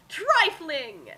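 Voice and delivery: ordinary talk, nothing marked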